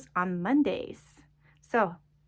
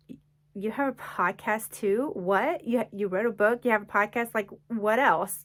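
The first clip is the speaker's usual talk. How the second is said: imitated incredulous doubter, getting louder; snarky disbelief; 2 semitones higher, 5 dB louder than usual. People questioning the author's book and podcast, snarky disbelief. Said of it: "this little snarky attitude from people".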